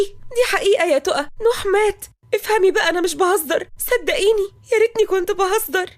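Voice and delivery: high, crying plea to be believed; distraught insistence. Acted. Sister swearing to the bride it is true, distraught. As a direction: insist through tears.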